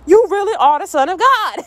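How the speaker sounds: high, hammed-up amazement; mock-astonished high-pitched cry; awed exclamation. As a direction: exclaim it in comic wonder. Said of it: "They exclaimed".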